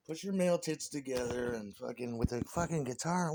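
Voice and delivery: normal talking voice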